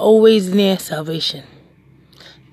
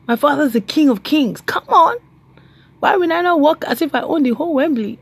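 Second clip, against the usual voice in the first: strut with every word like royalty; royal swagger; 7 semitones higher than usual. Retold or imitated. Woman boasting to a man about her royal father, high and proud. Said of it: "I was like".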